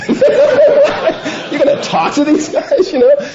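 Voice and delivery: high laughing disbelief, slow. Real, not acted. Incredulous, laughing retelling.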